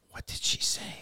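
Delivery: put-on near-whispered comic French accent; soft, voiceless, bad French accent. Friend puzzled by the Quebec lady, in a hushed French accent. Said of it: "I love [name]'s French accent".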